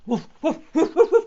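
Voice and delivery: loud, quick woofing; dog barks. A narrator's reading. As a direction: bark it out like an excited dog. Distant dog barking.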